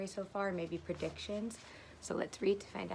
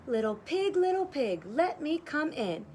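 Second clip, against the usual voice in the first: higher, louder sing-song wolf call; wolf at the door; 7 semitones higher, 5 dB louder than usual. Acted.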